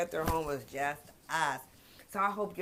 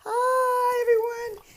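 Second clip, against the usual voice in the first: very high put-on dog voice; snooty poodle voice; 9 dB louder, much slower than usual. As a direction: speak for the little dog, way up high. Voicing her poodle.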